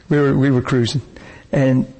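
ordinary delivery

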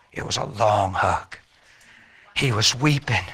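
ordinary talk, nothing marked

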